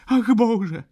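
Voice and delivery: very high, loud cry; horrified scream. A narrator's reading. Baroness reeling at the lodger's words, crying out. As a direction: shriek it in sudden dismay. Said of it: "krzyknęła znowu pani baronowa".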